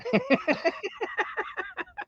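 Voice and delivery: laugh. Real, not acted. Laughs at harass remark.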